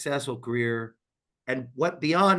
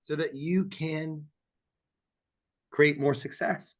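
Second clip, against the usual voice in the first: infomercial voice; 2 semitones higher than usual. Pretend. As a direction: sell it like a late-night ad. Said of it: "Now I sound like an infomercial at four A M".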